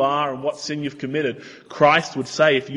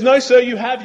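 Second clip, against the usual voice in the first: insistent salesman; 7 semitones higher, 6 dB louder than usual. Retold or imitated. Telemarketer insists caller has won.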